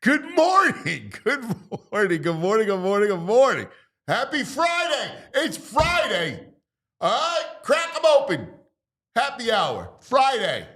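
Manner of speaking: high, stretched-out, exuberant Friday greeting; hyped greeting. Real, not acted. Host greeting listeners at show open, hyped. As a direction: crow it out high with big falling swoops.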